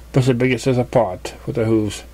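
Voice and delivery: ordinary delivery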